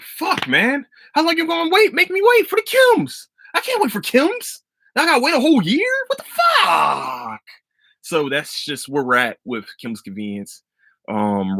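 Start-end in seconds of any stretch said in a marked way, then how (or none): 0.0-7.4 much higher, whiny frustrated complaint; exasperated whine. Retold or imitated. Waiting a year for the show, a high whiny complaint. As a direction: whine it up high in mock despair. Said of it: "So I'm like".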